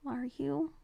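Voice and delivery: put-on soft, slow, timid girl; shy Julica. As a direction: ask in a hushed, disbelieving voice.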